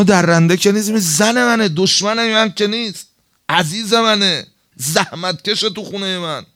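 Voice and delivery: high, loud pleading cry; impassioned rebuke. Retold or imitated. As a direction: scold yourself out loud. Retold inner rebuke, preaching. (فریاد پرشور)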